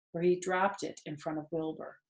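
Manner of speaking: usual speaking voice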